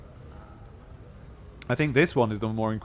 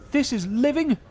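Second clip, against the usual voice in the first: bitter dustman; 12 semitones higher, slower than usual. Acted. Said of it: "He bares his teeth and his hands clench".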